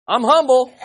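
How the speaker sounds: proud, loud smug claim; smug boast. Pretend. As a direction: say it like you're proud of your humility. Proud boast voiced for effect.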